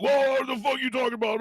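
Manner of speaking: dad caught off guard, mimicked high; mocking the dad. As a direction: play the dad as a startled squeaky guy. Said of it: "And the dad's like".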